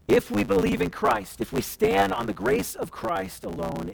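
ordinary talk, nothing marked

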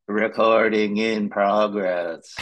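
slow, flat recorded-message voice; robot voice. Retold or imitated. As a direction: read it like a machine announcement. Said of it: "a very robotic voice said".

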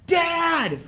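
put-on high child's cry of Dad; calling after him like a small kid. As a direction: kid calls after the departing guest, high-pitched cry. Kid calls out as they leave.

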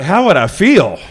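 high, loud, overexcited comeback; hammed-up fan disbelief; incredulous excitement. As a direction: act out being bowled over by an offer. Acted overexcited reply to friend's offer.

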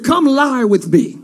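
put-on slow, solemn old-scripture line; the brother says it like old scripture, slowly; ancient biblical come-on. Brother to sister in old scripture, slow and solemn. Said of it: "a very three hundred way to say".

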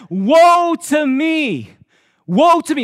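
loud, slow wail of despair; preacher voicing the prophet's loud lament; anguished cry. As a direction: cry out as if ruined. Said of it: "It says".

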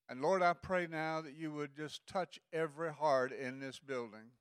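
earnest prayer, swelling on praise lines; offering prayer. Acted. Elder praying over missions offering, earnest and building. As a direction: pray the offering in, building on all the glory.

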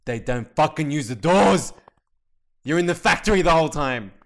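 loud, angry outburst; heated fan rant at full volume; exasperated shout. Real, not acted. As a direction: yell it in fed-up disbelief. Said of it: "oh, I'm so mad".

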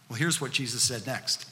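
ordinary talk

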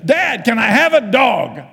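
loud, high, drawn-out plea to Dad; big childlike request, stretched out; pleading kid. Acted. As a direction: call out like a kid begging a parent. Child asking father, acted.

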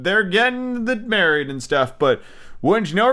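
usual speaking voice

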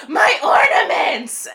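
high, loud mock wail; imitating a panicked mom's drawn-out shout; mom freaking out. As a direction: cry it out in mock horror. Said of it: "and freaks out".

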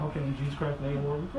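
hushed, fast, low prayer under pressure; keeping cool. Retold or imitated. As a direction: pray quickly under your breath, holding back panic. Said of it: "I'm trying to keep my cool".

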